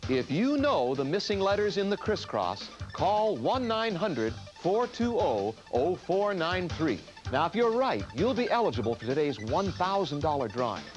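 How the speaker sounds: upbeat TV promo voice; announcer read. Acted. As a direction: read it like a game show promo. Game show promo read.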